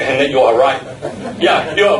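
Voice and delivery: usual speaking voice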